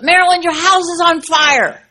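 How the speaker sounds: loud mock yell up the stairs; neighbor yelling up. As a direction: call up urgently to the upstairs. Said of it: "she yells up".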